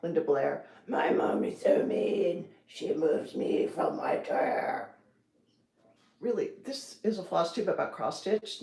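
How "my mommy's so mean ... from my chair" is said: put-on slow, whispered possessed-girl voice; Exorcist voice. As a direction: rasp it out like a possessed child. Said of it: "the Exorcist voice, Linda Blair".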